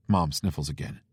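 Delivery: ordinary delivery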